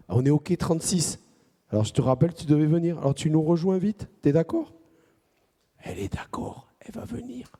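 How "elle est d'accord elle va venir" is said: put-on soft secret whisper to the kids. Leader whispering the news to the children. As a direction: share the news in a hush.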